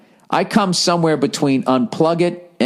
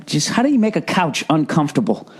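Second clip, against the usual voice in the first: ordinary talk, nothing marked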